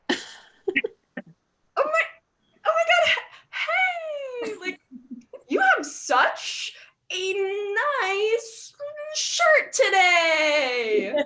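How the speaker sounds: high, gushing mock enthusiasm, dragged out; gushing fake compliment. Comic to her twin, high gushing fake praise. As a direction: pile on the fake sweetness to the twin. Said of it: "I'm like".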